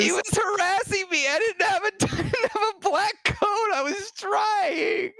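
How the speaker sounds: high, squealed protest through laughter; laughing, high-pitched excuse, last word dragged out; mock-wailing self-defence. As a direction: plead your case in a hammed-up whine. Mock-defends self against teasing accusation.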